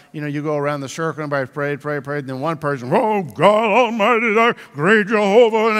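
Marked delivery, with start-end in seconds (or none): 2.9-5.8 show-off prayer. Retold or imitated. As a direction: pray grandly to impress the whole circle, higher and louder. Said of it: "show-off prayer".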